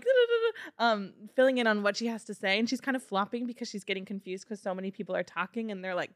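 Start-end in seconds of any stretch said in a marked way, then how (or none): 0.0-0.9 high-pitched babble of advice; quick nonsense syllables in a high voice; imitated chatter.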